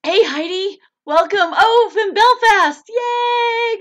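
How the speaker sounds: high, loud squeal of welcome; excited greeting. Real, not acted. Host welcomes a viewer from Belfast, excited shouted greeting. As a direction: call out in delight, building to a squealed cheer.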